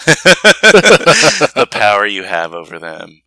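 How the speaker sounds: slow words through laughter; laughing. Real, not acted. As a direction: laugh as you say it. Laughs at his yawning joke.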